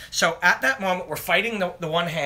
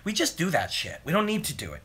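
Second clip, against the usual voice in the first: normal talking voice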